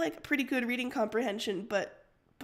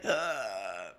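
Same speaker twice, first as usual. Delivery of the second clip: groan; 14 semitones lower than usual. Retold or imitated. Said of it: "I'd be like".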